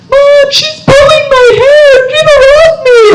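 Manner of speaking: very high-pitched mock kid's wail; screaming child. Two little girls fighting as mom records, imitated high screaming. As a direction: screech like a squabbling little girl. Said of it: "screaming, fighting".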